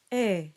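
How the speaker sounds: e held steady like bed; pure Latin e. Shown as a demonstration. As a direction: say e as a pure open e with no glide. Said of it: "rather we want".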